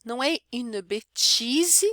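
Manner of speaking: "bêtise" said betchizi, Brazilian style; French with Brazilian accent; wrong Brazilian version. Shown as a demonstration. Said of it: "falar assim fica bem brasileiro".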